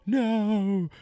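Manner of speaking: high mock panicked no's; imitating a terrified girl; frightened pleading. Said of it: "she keeps going".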